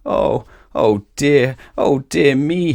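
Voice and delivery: a hammed-up wail over lost money. Miser wailing at the wizard over his lost money, mock wail. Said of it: "he wailed".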